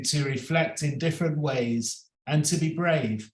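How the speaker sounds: ordinary talk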